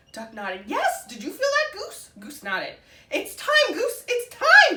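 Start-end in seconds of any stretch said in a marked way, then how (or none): 0.7-2.1 much higher and louder; thrilled, voice leaping; excited. A narrator's reading. Duck feeling the egg move, high thrilled question. 3.1-4.8 very high, very loud, slow squawk. A narrator's reading. Duck squawking to Goose that the egg is hatching. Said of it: "Duck squawked".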